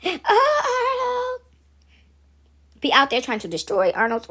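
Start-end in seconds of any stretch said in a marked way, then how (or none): 0.0-1.4 high, swooning sigh over a sweetheart; hammed-up lovesick cartoon voice; Helga. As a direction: moon over the beloved's picture, high and stretched. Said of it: "she would have his picture".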